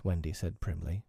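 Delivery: ordinary delivery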